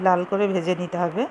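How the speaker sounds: usual speaking voice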